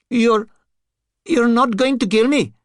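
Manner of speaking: high, loud, stammering fear; tearful, frightened. Acted. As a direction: still tearful, hoping to be spared. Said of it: "blinked through his tears".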